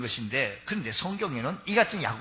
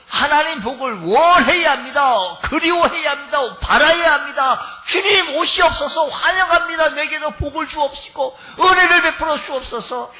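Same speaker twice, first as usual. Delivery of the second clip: much louder, higher shouted exhortation; preaching at full force; 9 semitones higher, 9 dB louder than usual. Real, not acted. Preacher shouting a plea to the Lord for blessing. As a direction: cry out to God.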